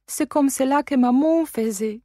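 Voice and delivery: put-on high child's voice, "comme cela" light and coquettish; French little girl. A narrator's reading. Adèle asking her guardian if she does it like maman, high and coquettish. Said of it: "dodała".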